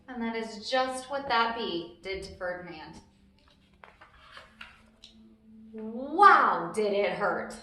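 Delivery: gentle storybook narration; reading to children. A narrator's reading. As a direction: read it like a bedtime story for kids. Reads picture book to children.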